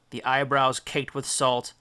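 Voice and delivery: ordinary delivery, nothing marked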